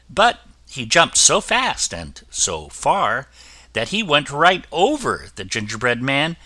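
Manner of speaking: usual speaking voice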